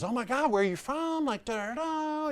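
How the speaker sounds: very high-pitched imitation of a chatty saleswoman; gushing shop lady. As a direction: squeal it like an overfriendly shop assistant.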